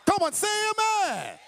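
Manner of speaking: high, impassioned preacher with drawn-out key words; full-force preaching. Real, not acted. Preacher assuring congregation of God's presence, full-force preaching. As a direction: preach it with long, rising emphasis.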